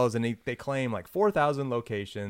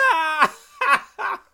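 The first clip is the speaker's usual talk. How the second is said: very high shrieking laugh; hysterical laugh; 6 dB louder than usual. Retold or imitated. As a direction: mimic a couple cackling at jokes. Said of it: "laughed hysterically at all his jokes".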